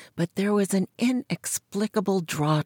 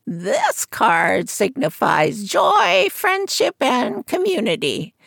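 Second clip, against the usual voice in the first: put-on high croaky old seer's voice; croaking fortune teller; 8 semitones higher, 4 dB louder, slower than usual. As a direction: croak it from behind a curtain. Madame Zora reading cards.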